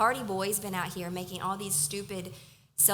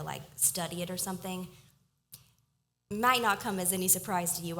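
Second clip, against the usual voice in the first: usual speaking voice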